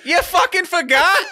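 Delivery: cracking up in disbelief; laughing disbelief. Real, not acted. Co-host laughs in disbelief at the forgotten appointment.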